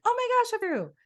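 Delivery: high, thrilled gasp of relief; mock-giddy self-quote; excited breakthrough. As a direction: replay the moment it finally clicked. Retelling a breakthrough moment.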